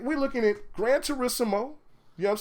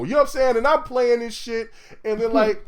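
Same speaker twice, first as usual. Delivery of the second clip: ordinary delivery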